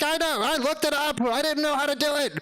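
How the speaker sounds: very high, eager gushing; giddy shared excitement; excited agreement. Retold or imitated. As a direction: answer like a proud nerd. Speaker's excited reply to delivery guy.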